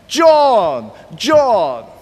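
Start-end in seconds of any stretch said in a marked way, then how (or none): none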